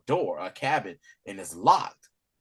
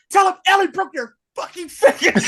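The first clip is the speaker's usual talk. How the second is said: high, loud triumphant threat; fierce defiant snarl; 12 semitones higher, 4 dB louder than usual. Retold or imitated. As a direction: spit it out in victory. Retelling Ellie's defiant line.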